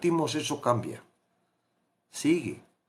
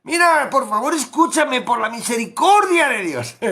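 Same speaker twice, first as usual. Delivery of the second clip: high, loud begging plea; hammed-up pleading friend, much higher; mock pleading; 9 semitones higher, 6 dB louder than usual. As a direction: beg like someone desperate. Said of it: "¿Alguna vez lo has dicho?".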